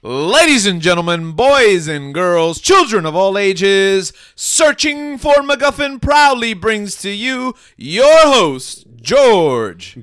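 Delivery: booming slow circus ringmaster. Acted. Show intro in announcer style.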